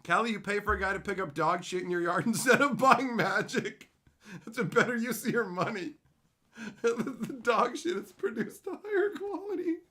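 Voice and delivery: reading a chat message, breaking into laughter; laughing while reading. Real, not acted. Streamer reading chat aloud, cracking up laughing.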